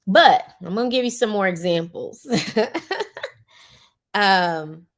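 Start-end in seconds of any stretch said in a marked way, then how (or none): 2.3-3.3 light real chuckle; brief chuckle to oneself; short laugh. Real, not acted. Speaker promising listeners more examples, short laugh.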